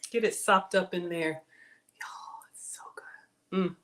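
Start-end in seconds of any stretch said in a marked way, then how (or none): 2.0-3.8 whisper. Real, not acted. Savoring a bite, confiding to viewers.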